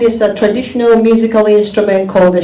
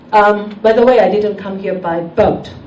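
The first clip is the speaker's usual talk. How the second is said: normal talking voice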